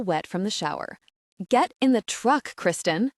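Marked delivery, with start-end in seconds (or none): 1.5-3.1 angry command. A narrator's reading. Husband storming in, ordering his wife out with an angry shout. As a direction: bark it at her from the doorway.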